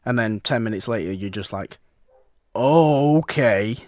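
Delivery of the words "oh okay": loud, drawn-out mock realization; acted realization. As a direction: play the viewer catching on with a big reaction. Voices viewer's reaction to reveal.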